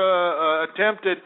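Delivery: usual speaking voice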